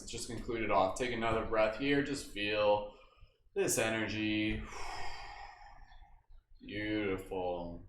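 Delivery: soft, slow, low calming voice; guided meditation. Acted. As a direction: settle them down gently. Calming down after movement.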